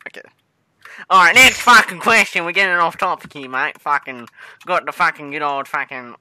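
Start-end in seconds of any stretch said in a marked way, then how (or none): 1.1-6.2 put-on Australian accent. Interviewer pulls a game chat back on topic, put-on Australian accent. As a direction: lay on a thick-headed Aussie accent, loudly. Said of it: "trying to do an Australian accent".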